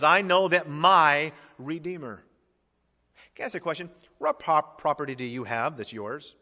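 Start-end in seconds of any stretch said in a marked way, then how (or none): 0.0-2.2 "my" drawn out and stressed. Shown as a demonstration. Preacher shows the Redeemer was Job's, stressing "my". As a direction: slowly land on the word "my". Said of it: "the Redeemer was also his".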